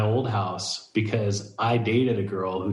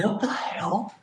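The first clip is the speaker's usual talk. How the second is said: high-pitched retold disbelief; shocked disbelief; 11 semitones higher, 3 dB quieter, slower than usual. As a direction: act out an incredulous reaction, pitched way up. Acting his reaction to Instagram post.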